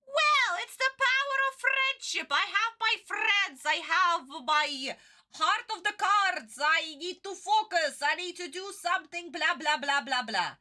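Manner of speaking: high, mocking cartoon-hero voice; mocking the dub. Streamer mocking the English dub's friendship speech, high and sarcastic. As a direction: ham up the preachy friendship speech. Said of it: "what did Forkins do with this beautiful message".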